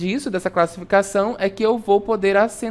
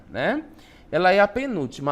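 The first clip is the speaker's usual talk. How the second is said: ordinary delivery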